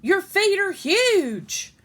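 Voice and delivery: high, loud and slow cry of shock. A narrator's reading. Sister shocked by Clementine's huge feet, loud and high. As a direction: blurt it out, horrified.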